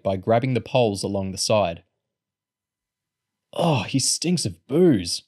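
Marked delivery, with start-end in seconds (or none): 3.5-5.2 mildly disgusted, drawn-out groan; higher, slow whine of complaint; complaining. A narrator's reading. Kid carrying a drunk man, complaining. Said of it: "someone complained".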